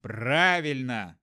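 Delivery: slow, emphatic exclamation; exclaiming. Acted. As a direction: cry it out with glee. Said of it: "воскликнул я". (восклицание)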